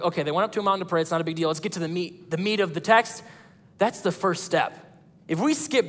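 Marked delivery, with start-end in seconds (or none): 0.0-3.2 rattled off fast and low, brushing it aside; dismissive rush. Retold or imitated. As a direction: hurry past it like it's no big deal. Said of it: "I'm like".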